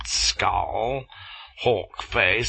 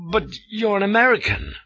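surprised; 13 semitones higher, 3 dB louder, faster than usual. A narrator's reading. Flashman in surprise.

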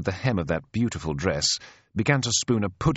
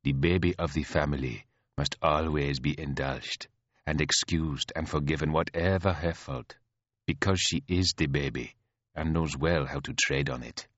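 low, measured, composed lecture; chiding elder sister; 4 semitones lower, 4 dB quieter than usual. Acted. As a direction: needle the spoiled sister evenly.

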